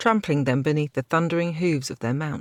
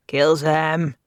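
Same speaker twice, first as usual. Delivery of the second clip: raving; 5 dB louder than usual. A narrator's reading. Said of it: "he raved".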